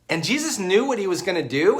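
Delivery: normal talking voice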